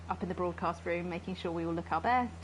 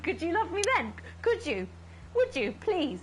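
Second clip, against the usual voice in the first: pleading; 8 semitones higher than usual. Acted. Begging Alex.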